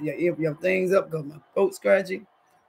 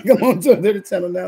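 laughing; 7 dB louder, faster than usual. Retold or imitated. Mock soap opera theme, laughing.

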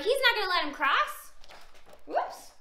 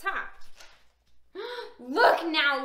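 plain reading voice

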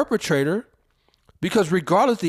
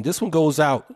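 normal talking voice

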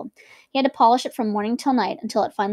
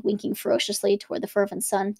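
ordinary delivery

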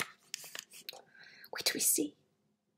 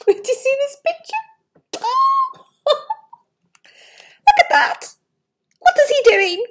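laughing, pitched high and bright; 13 semitones higher, 5 dB louder, slower than usual. Real, not acted. Reader shows the child the picture, laughing. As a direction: giggle over the picture.

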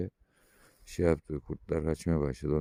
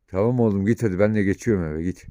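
louder, sending the dog off; raised voice to a dog; firm call to the dog; 4 semitones higher, 9 dB louder than usual. Real, not acted. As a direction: send the dog on its way. Sending dog away at end.

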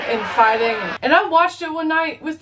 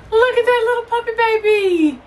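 cooing at puppy; 9 semitones higher, 3 dB louder than usual. Real, not acted.

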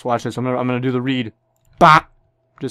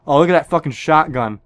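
ordinary talk, nothing marked

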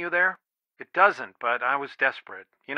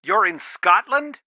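raised, insistent parental reprimand; stern scolding; 3 semitones higher, 4 dB louder than usual. Acted. Father ordering daughter home from Scotland, stern scolding. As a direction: lay down the law to your child.

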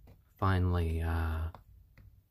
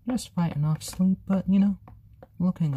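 excited cooing; 10 semitones higher, 5 dB louder than usual. Real, not acted. Cooing over sleepy chick.

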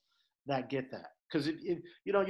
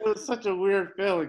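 real laughter breaking into loud, high words; laughing while talking; 6 semitones higher, 8 dB louder than usual. Real, not acted. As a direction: push the words out through laughing. Still laughing at his story.

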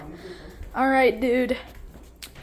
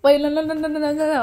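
panicked no; 3 semitones higher, 7 dB louder, faster than usual. Acted. Stopping friend from drinking.

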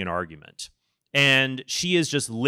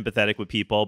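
ordinary delivery

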